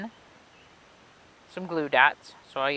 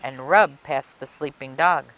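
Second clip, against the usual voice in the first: put-on lively read-aloud for children; story narration; 3 semitones higher than usual. A narrator's reading. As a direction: tell it like a picture book for kids. Reading a picture book aloud.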